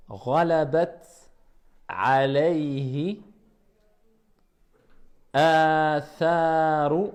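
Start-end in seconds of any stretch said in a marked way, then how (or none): 5.3-7.1 atharu with the first a held for two beats, slow; two-beat vowel. Shown as a demonstration. Teacher demonstrates the two-beat madd in atharu. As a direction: hold the a in atharu for two counts. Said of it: "la petite sorte de vague qui indique deux temps".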